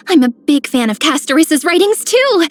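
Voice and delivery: excited outburst. Acted. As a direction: blurt it out too eagerly. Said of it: "did I say that too loud?".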